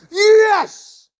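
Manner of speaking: high, loud burst of joy; triumphant yell. Retold or imitated. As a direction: shout it like you just won.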